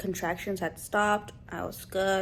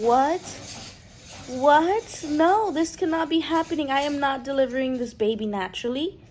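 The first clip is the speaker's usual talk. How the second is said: rising, higher panicked protest; panicked disbelief; 6 semitones higher, 4 dB louder than usual. Retold or imitated. Telling the nurse in shock she can't deliver naturally, panicked. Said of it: "The woman was too stunned to speak".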